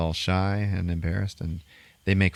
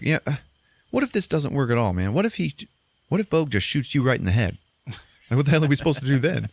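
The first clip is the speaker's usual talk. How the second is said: high-pitched, agitated mock protest; retold worried challenge; 7 semitones higher than usual. Doubter questioning the leader's battle plan, mock agitation. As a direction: push the worried objection upward, hammed-up.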